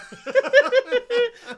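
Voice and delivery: big shrieking laugh; loud high laugh. Real, not acted.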